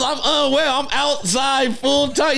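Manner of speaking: high, laughing mock voice of the man; imitating the homeless guy. Mocking the homeless man's line while laughing. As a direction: ham up a crazy man's claim through laughter. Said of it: "he goes".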